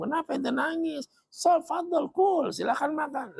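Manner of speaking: high, eager host urging a guest to eat; a lightly voiced Medinan host pressing food on his guest; inviting host. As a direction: offer the feast in a high coaxing voice.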